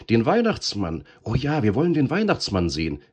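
high, loud eager shouting; children calling out in delight; excited children's cry. A narrator's reading. Children calling out for Santa, loud and eager. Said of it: "riefen die Kinder".

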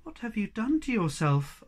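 understated panic; frantic. Acted. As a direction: ask it in mild alarm. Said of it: "asked Moomin Troll frantically".

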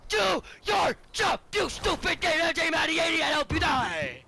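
screaming with rage at a game; high, loud angry yelling; furious shout. Real, not acted.

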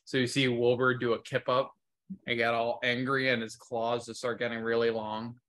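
normal talking voice

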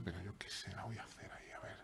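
whispered aside. Real, not acted. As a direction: hush the move to himself. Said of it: "susurra su jugada".

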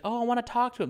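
put-on high old lady voice; quirky old lady.

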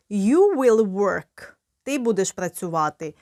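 usual speaking voice

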